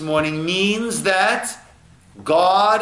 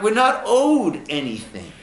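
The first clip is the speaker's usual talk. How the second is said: ordinary delivery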